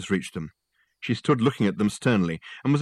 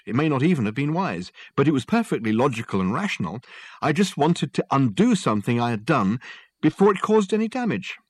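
higher, emphatic self-justification; defensive Richard; 5 semitones higher than usual. Acted. Client defending his break-in to the detective, defensive. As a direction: insist that it all made sense.

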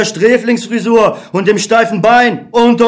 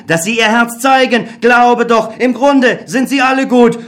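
higher, urgent inner voice; optimistic Other; 4 semitones higher than usual. Acted. The Other urging Beckmann to trust people. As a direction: coax him to believe.

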